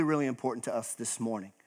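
ordinary talk, nothing marked

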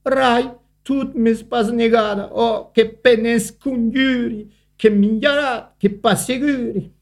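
high, thin, begging ant voice; a small frightened ant calling for help; pleading little ant. A narrator's reading. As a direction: plead for rescue in a tiny high voice. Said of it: "E cu cride en sue lati".

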